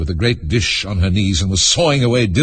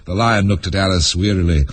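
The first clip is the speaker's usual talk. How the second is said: usual speaking voice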